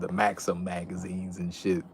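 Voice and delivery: ordinary talk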